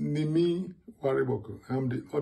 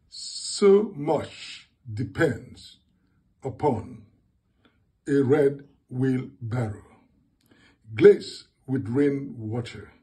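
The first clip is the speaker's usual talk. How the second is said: deliberate, drawn-out verse with long pauses; spaced-out, emphatic poetry reading; poem reading; 4 dB louder, slower than usual. Acted. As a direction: read it phrase by phrase, weighting each word. Reads a poem aloud.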